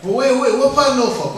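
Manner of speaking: acting out a hometown boast; lightly hammed-up banter; mock bragging exchange. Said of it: "getting all around the world".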